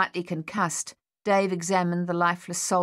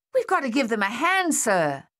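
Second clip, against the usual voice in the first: high, swooping shouted plea; urgent yell; 6 semitones higher, 3 dB louder than usual. A narrator's reading. Thaddeus pressing the captain to attack, yelling. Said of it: "Thaddeus yelled".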